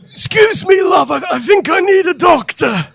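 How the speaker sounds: loud, high, pleading patient voice; high patient plea. Retold or imitated. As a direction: call out to the nurse in a strained high voice. Patient voiced in hospital story.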